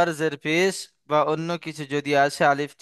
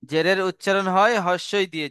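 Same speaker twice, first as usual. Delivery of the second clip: higher, brisker, unbroken phrase; run-through in one go; 4 semitones higher than usual. Shown as a demonstration. Teacher restating the zer rule before examples, in one unbroken run. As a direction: say it straight through without pausing at হয়.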